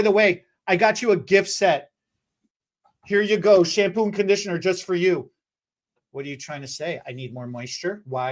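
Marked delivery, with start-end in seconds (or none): 6.1-8.3 offended murmur. Retold or imitated. Client offended by gift.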